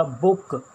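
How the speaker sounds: बुक with a short, quick oo; short vowel. Shown as a demonstration. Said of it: "sound इसका short हो गया".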